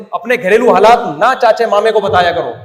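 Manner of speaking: loud, fast scolding of the audience; a real telling-off. Real, not acted. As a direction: tell them off firmly. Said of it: "اب تو میں ڈانٹنے پر آ جاؤں گا".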